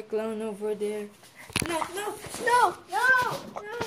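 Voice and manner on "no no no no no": high, rising string of cries; panicked refusal. Acted. Clown coming closer, panicked. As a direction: plead in mounting panic.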